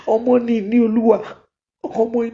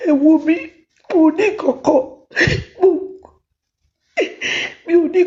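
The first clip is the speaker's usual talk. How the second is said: loud, higher, desperate; tearful loud plea; pleading cry; 6 semitones higher, 3 dB louder, much faster than usual. Real, not acted. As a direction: plead through tears. Begging mother, loud and high.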